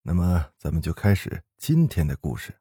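usual speaking voice

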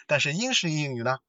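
usual speaking voice

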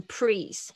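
"please" said with R, as "prees"; mispronounced L. Shown as a demonstration. Teacher imitates students' "please", mispronounced as "prees". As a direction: swap the L in "please" for an R. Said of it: "有几个人会念成please".

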